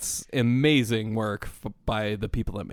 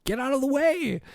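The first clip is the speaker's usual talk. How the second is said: exasperated; 13 semitones higher than usual. Retold or imitated. Annoyed at screen clutter.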